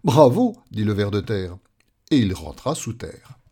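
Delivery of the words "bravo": loud, high exclamation of praise; admiring cheer. Acted. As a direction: cheer the great feat. Worm applauds the frantic leap. (bravo admiratif, lancé fort)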